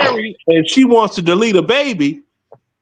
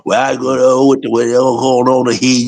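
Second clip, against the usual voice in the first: mumbled slang; 6 semitones lower than usual. Retold or imitated. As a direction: lower mock of mumbled, busted slang. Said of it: "They sound like Papa Bear".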